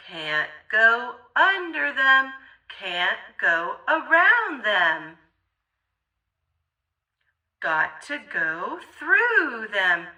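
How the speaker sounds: ordinary delivery, nothing marked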